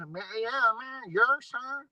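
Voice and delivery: mock the rapper's Memphis accent lightly; Memphis accent mimicry. Mocking the rapper's Memphis way of talking, light imitation. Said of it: "talking like a".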